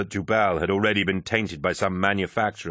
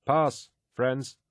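hidden guard voice; 6 semitones higher than usual. Acted. Figure admitting them.

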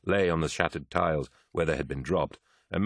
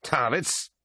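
high, disgusted questioning of the name; spat out; 8 semitones higher than usual. A narrator's reading. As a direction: spit the name out in outrage. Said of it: "spat Eidolon".

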